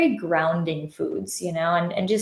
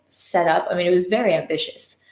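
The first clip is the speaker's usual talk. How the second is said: ordinary talk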